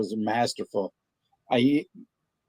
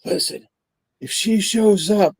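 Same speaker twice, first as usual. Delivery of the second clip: higher, pleading husband voice; prank caller; 7 semitones higher than usual. Retold or imitated. Prank caller asking the pizza clerk for his wife, pitched-up worried husband. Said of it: "And he says".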